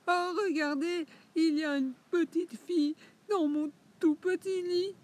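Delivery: very high, loud piercing cub voice; little piercing voice. Acted. Le petit ours trouve la fillette dans son lit, cri aigu. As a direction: shriek it like a tiny alarmed bear. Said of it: "petite voix perçante".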